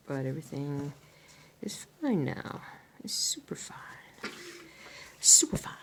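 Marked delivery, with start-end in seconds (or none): 1.6-5.7 whispering. Real, not acted. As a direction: whisper it gently. Whispered reassurance.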